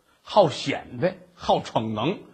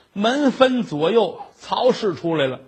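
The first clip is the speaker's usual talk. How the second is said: plain reading voice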